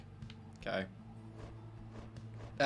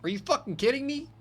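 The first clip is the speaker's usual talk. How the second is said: outraged disbelief; 14 semitones higher, faster than usual. Real, not acted. Game text hurts him badly.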